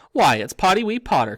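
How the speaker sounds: high, loud cackling taunt; mischievous ghost jeering at a boy; cackling Peeves. A narrator's reading. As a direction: cackle it out like a gleeful poltergeist. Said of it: "cackled Peeves".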